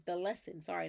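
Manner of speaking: ordinary talk, nothing marked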